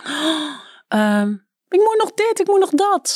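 high-pitched mock fretting; mock panic. Writer imitating her own stressed thoughts, mock-panicky.

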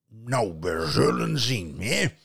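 loud troll muttering. Acted. Troll scanning the farm, loud. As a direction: threaten like a troll.

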